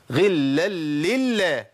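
غلا لله stretched very slowly, every lam shown; slow doubled lams. Shown as a demonstration. Teacher isolates the merged lams. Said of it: "التنوين في اللام".

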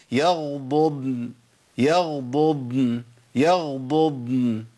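يغضضن very slow, second ض held still and long; الضادين واضحتين. Shown as a demonstration. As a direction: stretch يغضضن so both Dads stand apart. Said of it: "أبين الفرق بينهم".

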